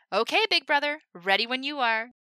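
loud, high ringmaster voice; big carnival presentation; showman patter. Acted. Himmel introducing the Human Top, loud showman voice.

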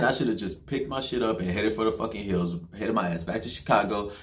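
ordinary talk, nothing marked

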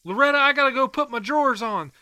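calling out. Acted. Calling out to wife Loretta.

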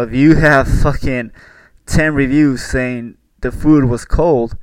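loud ranting at chefs; loud exasperated rant. Real, not acted. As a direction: yell it at stubborn chefs. Ranting about cold food complaints.

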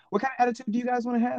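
quick, high-pitched classroom question; teacher prompting class. Retold or imitated. Teacher quizzes class on attitude, imitated quick prompting.

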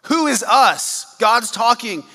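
emphatic preaching. Real, not acted. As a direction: drive it home to the congregation. Preaching at full force.

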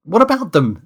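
high, loud baffled question; bewildered. Acted. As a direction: ask as if completely thrown. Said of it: "said a bewildered Kate".

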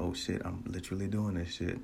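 very soft aside to yourself; retold inner thought, barely voiced; hushed realization. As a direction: drop to an under-the-breath murmur. Retold thought while running.

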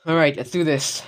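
ordinary talk

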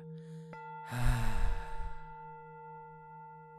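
long breathy release of air; audible exhale. Shown as a demonstration. (exhalación audible y larga)

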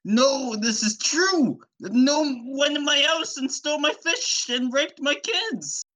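high-pitched over-the-top victim; Fisherman Joe. Acted. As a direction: insist frantically in a high cartoon voice. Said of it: "And he was like".

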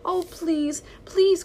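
high pleading cry, rising into the second please; frightened dog begging the tramp for help. Acted. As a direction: beg desperately, voice climbing. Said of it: "cried Lady".

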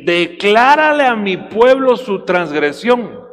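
slow, loud scripture proclamation; preaching at full force. Acted. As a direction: thunder the verse to the church. (predicación lenta y fuerte)

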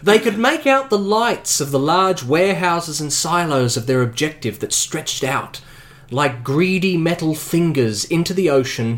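measured game master narration; story narration. A narrator's reading. Game master reading the scene to the players, narrating. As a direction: set the scene for the players, steady and descriptive.

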